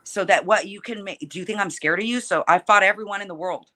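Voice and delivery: usual speaking voice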